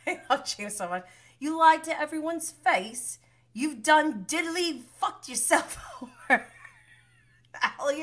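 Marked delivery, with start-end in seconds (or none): 1.4-6.4 high, loud-edged mock telling-off; acted scolding. Imitating James confronting Raquel, amused scolding. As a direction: scold in a raised pitch, tipping into laughter.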